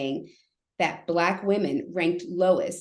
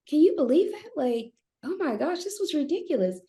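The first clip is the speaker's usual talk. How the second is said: much higher, exasperated disbelief; incredulous venting; 10 semitones higher than usual. Retold or imitated. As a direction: vent your outrage to your husband. Venting to husband after store.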